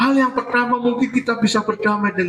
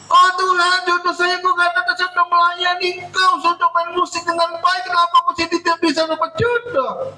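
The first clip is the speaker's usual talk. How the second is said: complaining to God; 9 semitones higher than usual. Acted. Lonely church musician complaining to God, high pleading lament. Voices a single believer complaining.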